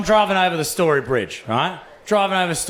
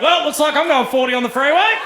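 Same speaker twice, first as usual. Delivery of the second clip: mock bloke; 10 semitones higher, 4 dB louder than usual. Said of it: "Every bloke in the country went".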